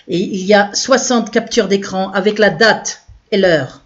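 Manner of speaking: usual speaking voice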